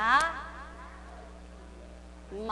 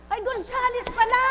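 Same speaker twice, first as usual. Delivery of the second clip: 1 surprised; 9 semitones higher, 3 dB louder than usual. Real, not acted. Mock surprise at name.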